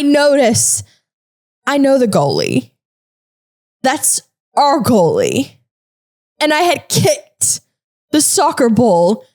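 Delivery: halting reveal with long pauses; dramatic suspense. Retold or imitated. Storyteller revealing she scored in her own goal, drawn-out suspense. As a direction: break it into short phrases, stretching the key words.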